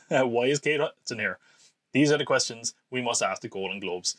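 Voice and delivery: normal talking voice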